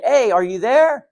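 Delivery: calling out. Retold or imitated. Woken at night, the speaker calls out to their source, loud call. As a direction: call out hoping someone answers.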